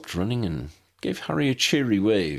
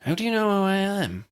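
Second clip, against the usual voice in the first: put-on higher, challenging American accent; American agent demanding answers; 13 semitones higher than usual. A narrator's reading. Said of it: "she asked in an American accent".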